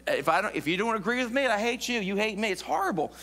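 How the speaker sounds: usual speaking voice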